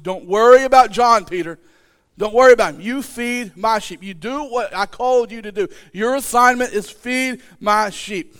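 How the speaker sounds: preaching fervently, rising in volume; preaching loud. Real, not acted. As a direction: drive the command home to the crowd. Preacher at full force.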